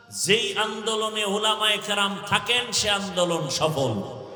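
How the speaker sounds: ordinary delivery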